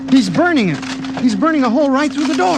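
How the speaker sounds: high, fast, frightened; a high, quick panicked warning about the door; alarmed panic. Acted. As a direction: blurt out the alarm in a rush. Someone burns through the door.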